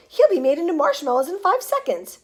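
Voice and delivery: high, loud indignant protest; snapping. A narrator's reading. Mother disbelieving Wonka's reassurance, snapping. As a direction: snap it back in alarm. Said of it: "snapped Missus Gloop".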